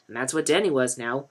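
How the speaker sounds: ordinary delivery, nothing marked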